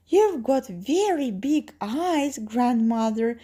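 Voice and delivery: put-on high, slow wondering little girl. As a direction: draw it out high like an amazed child.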